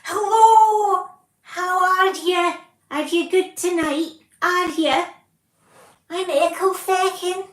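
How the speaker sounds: high, loud, hammed-up Scottish accent; a deliberately bad, over-the-top Scottish accent greeting a crowd. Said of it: "Surely you can do a convincing Scottish accent".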